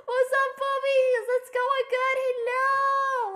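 high, drawn-out singsong hello; excited greeting. Real, not acted. Streamer hailing the audience, excited greeting. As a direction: hold it high and stretch the words.

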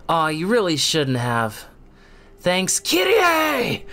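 high overdramatic yelling hero; dramatic Nero. Pretend. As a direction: yell everything like a melodrama. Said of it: "dramatic Nero".

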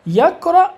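يقرأ said high and loud with no nun; يقرأ called out as the short form; plain verb form. Shown as a demonstration. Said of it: "يجوز أن أقول".

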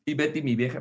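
usual speaking voice

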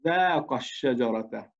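"ذاق الشجرة" read slowly with no alif sound in "ذاق"; "ذاق" said short, straight into "الشجرة"; slow correct reading. Shown as a demonstration. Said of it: "نحذف الألف ونقول".

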